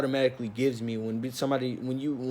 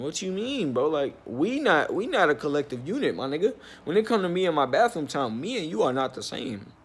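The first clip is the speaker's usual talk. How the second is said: retold indignant outburst, pitch swooping up; indignant comeback; 4 semitones higher than usual. Storyteller answers back to the bathroom-policing manager, indignant. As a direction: protest in disbelief, stretching the first words.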